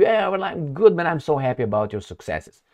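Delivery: high, bright fake enthusiasm; mock cheerful caller. A caller gushing over your success, mock cheerful. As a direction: play the flatterer calling to gush.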